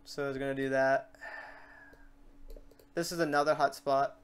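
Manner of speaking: ordinary talk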